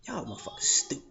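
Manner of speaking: whispered scoff; whispered dismissal of the girls, under the breath. Retold or imitated. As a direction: mutter it under your breath. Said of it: "You're like".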